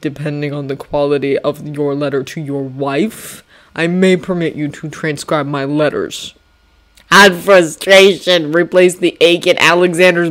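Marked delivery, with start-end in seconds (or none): 0.0-6.3 lower, measured commanding general; General Washington. Acted. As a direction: speak like a calm stern general. Washington voiced in story.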